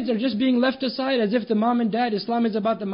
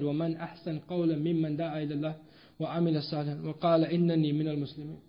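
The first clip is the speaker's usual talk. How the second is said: rapid, softer Arabic verse recitation; Quran recitation; 3 semitones lower, 5 dB quieter, much faster than usual. Acted. Preacher quotes the Quran to the congregation, rapid recitation. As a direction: recite the verse quickly and reverently.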